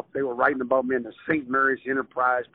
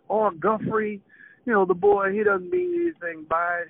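pleading mother voice, pitched higher; soothing mom; 14 semitones higher than usual. Retold or imitated. Mother pleads the son's case to father, higher-pitched. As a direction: mom calming dad about their boy. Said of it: "my mom was like".

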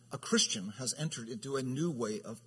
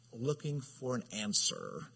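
ordinary delivery

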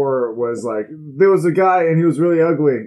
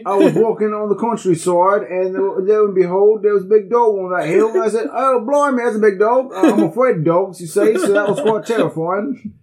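much higher, louder British yarn; mock British accent; 8 semitones higher, 3 dB louder than usual. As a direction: spin a dull tale as a silly Brit. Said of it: "the British horror story".

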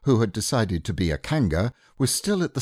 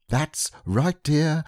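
slow, higher gentle hush; soothing mother; 6 semitones higher, slower than usual. Acted. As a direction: hush the child. Kanga voiced in story.

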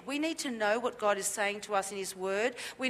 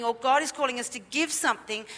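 ordinary delivery

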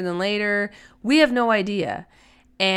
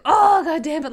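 exasperated outburst; 9 semitones higher, 7 dB louder than usual. Retold or imitated. Imagined setback ruining a planned event.

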